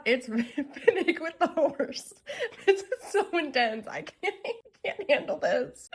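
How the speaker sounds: giggling through the words, high; can barely talk for giggles; laughing. Real, not acted. Fan reacting to Finnick picture, giggling helplessly.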